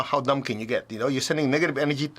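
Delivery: ordinary talk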